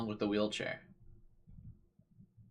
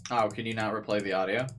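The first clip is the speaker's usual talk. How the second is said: ordinary delivery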